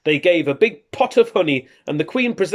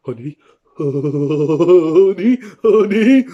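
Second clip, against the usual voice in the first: lightly voiced bear, building louder; greedy bear; 5 dB louder, much slower than usual. Norman the bear spotting the honey store, building greedily. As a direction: crave it more each time.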